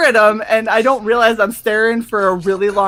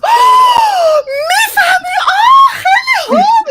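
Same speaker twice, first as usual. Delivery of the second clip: much higher, louder overjoyed exclaim; delighted shriek; 6 dB louder than usual. Retold or imitated. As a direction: gush like a thrilled auntie. Lady delighted she speaks Farsi.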